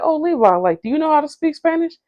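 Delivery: high, quick, playful question; high teasing voice. Retold or imitated.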